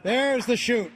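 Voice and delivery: loud, thrilled call-out; spotting the chute with a rush of excitement; excited. Real, not acted. Commentator spots the parachute opening.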